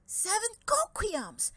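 high, loud cry of greedy delight; excited exclamation. Acted. Greedy dragon spotting the cocoyam pile, excited high exclaim. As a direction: squeal it like a dragon who spots treasure. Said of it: "he exclaimed".

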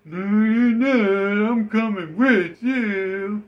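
very high, loud, slow drawn-out character voice; high slow mock NPC refusing to leave; creepy NPC voice. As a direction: stretch it out like a clingy game bot. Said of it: "And then he's like".

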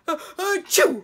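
huge, high, loud acted sneeze; explosive sneeze. A narrator's reading. Dragon caught in a sneezing spasm, violent sneeze. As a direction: build up and blast out a dragon sneeze. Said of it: "accompanied the dragon's sneeze".

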